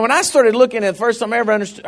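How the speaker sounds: usual speaking voice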